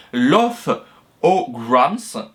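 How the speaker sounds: French speaker's attempt at an English accent; would-be English accent. Real, not acted. Vlogger naming Disney's first company, would-be English accent. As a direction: say the English name with a homemade English accent. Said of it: "mon super accent anglais".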